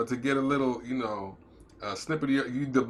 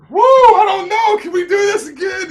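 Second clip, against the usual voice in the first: hammed-up panicked falsetto; mock overwhelmed new dad; 16 dB louder than usual. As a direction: squeak it out like a dad in over his head. Parent mock-quoting himself, new baby.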